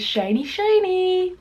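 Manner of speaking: dreamy food admiration; admiring. Real, not acted. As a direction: marvel at the gloss, drawn out. Admiring glistening food close up.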